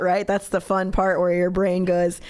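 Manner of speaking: ordinary talk, nothing marked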